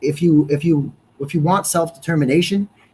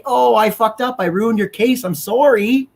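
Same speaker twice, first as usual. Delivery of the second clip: higher, whiny fake sorry; sneering, put-on remorse; whiny mocking apology; 8 semitones higher than usual. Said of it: "you don't get to say".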